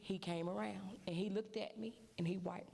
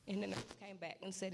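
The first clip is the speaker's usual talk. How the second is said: usual speaking voice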